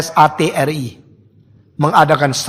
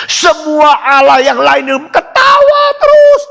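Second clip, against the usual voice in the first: high shouted cry; 9 semitones higher, 5 dB louder than usual. Acted. Gods laughing without end.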